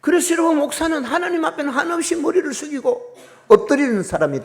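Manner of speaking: high, loud fervent preaching; preaching at full force. Real, not acted. Pastor exhorting the congregation, fervent preaching. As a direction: drive the point home to the congregation.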